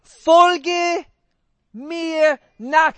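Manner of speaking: folge mir nach, hoch und laut gerufen; laut verkündet. Acted. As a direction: lautstark wie Jesus rufen. Jesus' Einladung im Predigthöhepunkt. (laut und feierlich ausgerufen)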